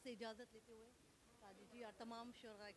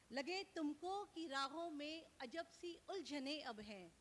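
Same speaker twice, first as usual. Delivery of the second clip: lifted, measured mushaira declamation; Urdu nazm recital; 3 semitones higher, 6 dB louder, slower than usual. Acted. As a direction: recite the verse out to the listeners, line by line. Poet continues her nazm.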